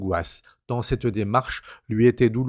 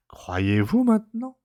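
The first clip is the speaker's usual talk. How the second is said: amused doctor; 10 semitones higher than usual. A narrator's reading.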